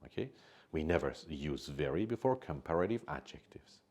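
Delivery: usual speaking voice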